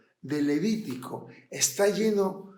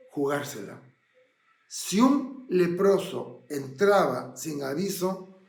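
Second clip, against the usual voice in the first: normal talking voice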